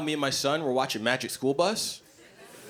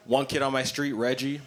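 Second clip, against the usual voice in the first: ordinary delivery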